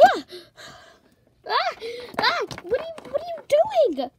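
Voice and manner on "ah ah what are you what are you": much higher and louder shriek; startled squeal of surprise; startled scream. Acted. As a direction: jump in fright and shriek. Startled by intruder.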